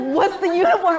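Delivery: high, loud mom voice; mother asking, amused; curious mom. Retold or imitated. Mother on the phone asking about the uniform, high and curious.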